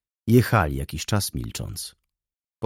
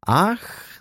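laughing exclamation; 12 semitones higher than usual. A narrator's reading. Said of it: "zawołała ze śmiechem".

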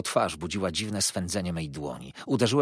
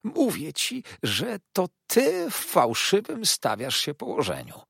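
high, slow, oily hushed voice; 11 semitones higher, 4 dB louder than usual. A narrator's reading. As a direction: hush him with false calm. Uriah keeps hissing at him. (cichy, powolny, obłudny głos)

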